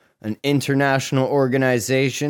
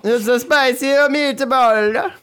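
hammed-up Italian, high and slow; spicy meatball cliché, stretched out and high; mock Italian accent; 11 semitones higher, 4 dB louder than usual. A podcaster lampooning the mobster's quote, hammed-up Italian. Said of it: "I thought you were gonna say spicy meatball".

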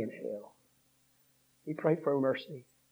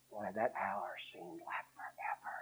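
whisper; 5 semitones lower, 11 dB quieter than usual. Retold or imitated. Recalling hospital ordeal.

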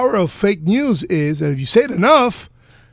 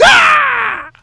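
high, loud yeehaw holler; cowboy whoop; 7 dB louder than usual. Retold or imitated. Mock yeehaw cowboy shout.

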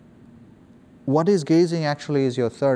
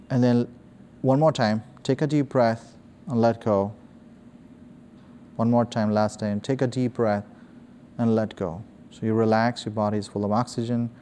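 soft, low guided breathing voice; right in your ear; 3 semitones lower, 3 dB quieter than usual. Acted.

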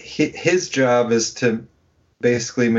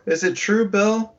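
pitched-up teasing question to Bill; high mock questioning; 10 semitones higher than usual. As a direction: ask it high and teasing, rising.

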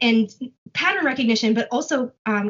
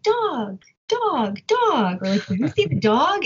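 put-on higher sing-song parent voice coaxing the baby; talking to a baby; 4 semitones higher, slower than usual. Parent naming the dog for the baby, sing-song baby talk. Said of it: "You're like".

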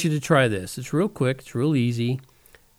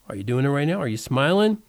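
usual speaking voice

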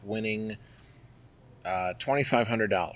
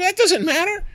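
much higher, louder curt retort; angry wife; 8 dB louder than usual. Retold or imitated. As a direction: cut him off, annoyed and shrill. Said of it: "And she's like".

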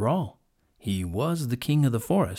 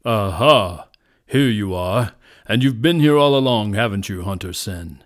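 loud lion, settling lower at the end; kingly authority; 3 semitones lower, 8 dB louder than usual. A narrator's reading. Lion confronts the hiding hunter, with kingly authority. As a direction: confront him like a commanding king. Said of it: "with the authority of a king".

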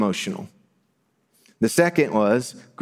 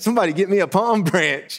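high-pitched, hammed-up eager onlooker; joyful excitement; 9 semitones higher than usual. Crowd member wanting a palm branch, joyfully excited. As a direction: play a thrilled crowd member calling out. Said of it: "this great joy they must have felt".

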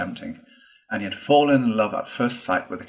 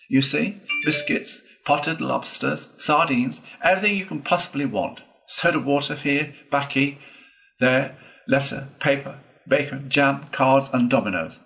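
higher-pitched, proud listing; triumphant; 6 semitones higher, 4 dB louder than usual. A narrator's reading. Toad pulling open a locker to show supplies, triumphant. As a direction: show off each item in turn. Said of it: "said the Toad triumphantly".

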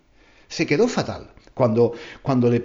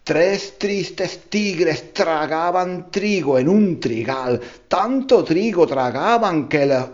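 very slow, each "tres tristes tigres" spelled out; slow tongue twister; 3 semitones higher, slower than usual. Shown as a demonstration. Teacher demoing the tiger twister, deliberately slow. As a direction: savour every "tr" in "trigo" and "tragaban".

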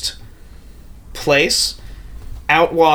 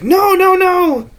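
very high, louder wailed refusal; acted anguished cry; 5 dB louder, slower than usual. Tamino begging the whispering spectre to stop, acted anguish. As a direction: cry out in hammed-up torment.

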